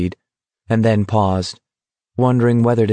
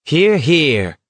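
higher-pitched, clearly pronounced call; quite clear; 6 semitones higher than usual. A narrator's reading. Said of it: "said quite clearly".

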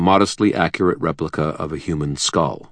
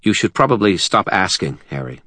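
harder and cooler; 4 semitones higher than usual. Acted. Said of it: "his voice came out harder and cooler".

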